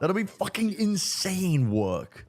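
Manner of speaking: slow, high, amazed drawl. Real, not acted. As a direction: stretch every word out in disbelief. Stretched-out amazed reaction.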